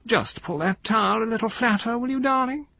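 very high, affected wheedle; high, drawling voice. A narrator's reading. Wife asking her husband for help, high drawling voice. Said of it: "went on in that high, drawling voice".

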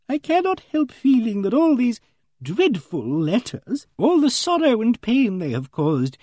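high, slow, pained lady's voice; earnest Emily Barton. A narrator's reading. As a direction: dwell on the sorrow, pitched high.

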